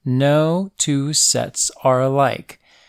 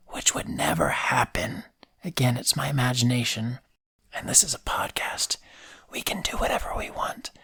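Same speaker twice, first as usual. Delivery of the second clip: soft unvoiced whisper; whispered aside; 8 dB quieter than usual. Real, not acted. Host confides to listeners in a whisper. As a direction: lean in and whisper it. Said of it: "whispered aside".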